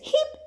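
a very high single hiccup; tiny lamb's squeak of a hiccup; squeaky hiccup. Acted. Lamb tossed in the air, high squeaky hiccup.

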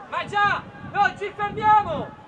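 rally the whole team, don't let up; rallying shout. Real, not acted. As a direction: coach yelling at the team not to stop. Coach urging team on.

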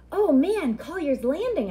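thrilled at the adventure; excited boy. Acted.

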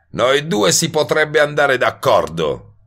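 ordinary delivery, nothing marked

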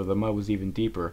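ordinary delivery

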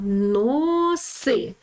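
"Носи" with a drawn-out О; reading by syllables. Shown as a demonstration. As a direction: stretch each syllable of "Носи" for learners. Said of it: "Читаємо". (протяжне читання слова «Носи»)

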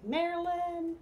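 high, loud exasperated call of Marilyn; exasperated husband. Retold or imitated. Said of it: "lays down and goes".

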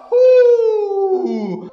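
high, loud, drawn-out "uh"; sustained whoop falling in pitch; long excited whoop. Real, not acted. Gamer reacting to the fruit roll, long high whoop.

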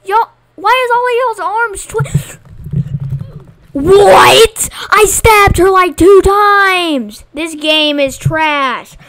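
loud, high, furious ranting; raging gamer. Real, not acted. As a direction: rage at the game, shouting. Raging at the game.

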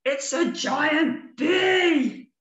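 very slow, high, loud panicked cry; scared bear shouting as it flees; frightened shout. Acted. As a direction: yell it out like a terrified bear. Bear terrified of giant bee.

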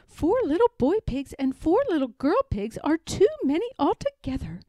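a harried mother pig at the end of her tether; fretful mother pig. Acted. Aunt Pettytoes complaining about her piglets, high and loud.

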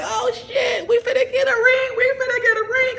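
very high, squealed and near crying with glee; high-pitched giddy squeal; squealing excitement. Retold or imitated. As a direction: shriek it like the ring is coming. Expecting a ring outside.

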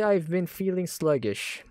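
ordinary talk

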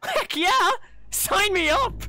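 high, loud excited yell; eager acceptance. Acted. Adventurer accepting the offer of magic, wildly eager. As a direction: jump at it with a squeal.

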